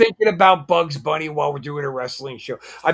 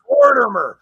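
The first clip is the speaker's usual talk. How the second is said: excited recognition; 8 semitones higher, 6 dB louder than usual. Real, not acted.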